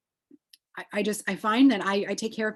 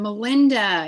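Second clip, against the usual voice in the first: calling out; 8 dB louder than usual. Real, not acted. Calling out to a participant.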